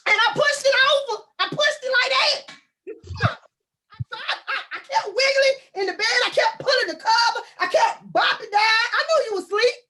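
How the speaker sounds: high, loud mock shout; comic squealing retelling; hammed-up high yelling. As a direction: yell it up high like a frustrated wife. Acting out nudging partner.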